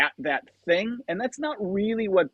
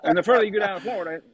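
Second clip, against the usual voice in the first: Southern drawl impression; 4 semitones higher, much faster than usual. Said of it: "It's pretty good Ricky Bobby".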